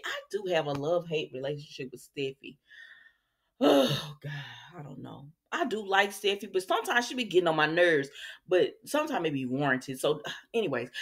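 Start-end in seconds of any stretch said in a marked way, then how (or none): none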